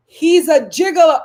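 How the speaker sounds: exclaimed gigolo; loud punchline. Shown as a demonstration. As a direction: shout the verdict to the room. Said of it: "repeated louder punchline".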